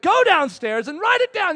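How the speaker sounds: high, loud order; acted exasperated command; insistent command. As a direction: order it emphatically. God orders him, acted.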